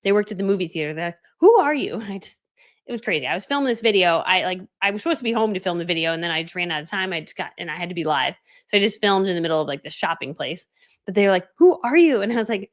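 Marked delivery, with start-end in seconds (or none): 1.4-2.0 high, loud mock wonder; imitated amazed kids. Movie theater kids ask who she is, imitated amazement. 11.6-12.2 loud, high-pitched mock wonder; imitated amazed kids. Cute kids spot her filming, imitated amazement.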